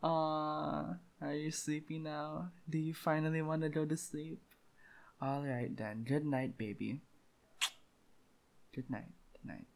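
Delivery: hushed and intimate, coaxing the listener to sleep; softly, like tucking someone in; whisper-soft girlfriend roleplay; soft bedtime voice. Acted.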